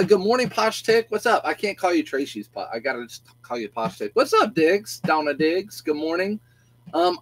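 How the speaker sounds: ordinary talk, nothing marked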